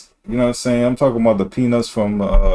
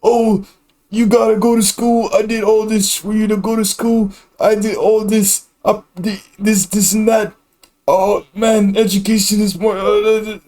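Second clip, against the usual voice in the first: high-pitched mocking voice; mocking naggers; 10 semitones higher than usual. Irritated streamer imitating folks who push school, mocking. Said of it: "I'm sorry if I'm mocking you".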